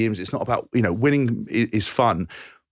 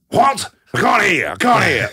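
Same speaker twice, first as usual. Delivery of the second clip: much higher, louder, confused; deaf old man shouting; 8 semitones higher, 5 dB louder, slower than usual. Retold or imitated. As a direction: shout like you can't hear yourself. Deaf Jeff being interviewed.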